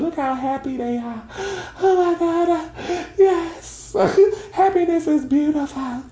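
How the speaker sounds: very high, tearful gushing; hammed-up weeping with joy, squeaky and overwhelmed; happy crying. Shown as a demonstration. Showing listeners crying because of love, high joyful mock sobs. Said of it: "I wanna cry 'cause of love".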